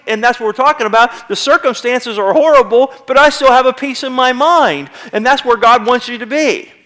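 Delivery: ordinary talk, nothing marked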